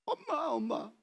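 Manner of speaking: crying for mother. Retold or imitated. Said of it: "하고 울었어".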